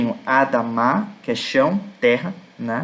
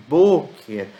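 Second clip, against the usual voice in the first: "boker" with a drawn-out, punched BÔ; teacherly BÔÔ-ker, falling; stress on first syllable; 3 dB louder than usual. Shown as a demonstration. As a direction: stretch the "bo" of "boker", then drop onto "ker". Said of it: "A sílaba tônica é no B".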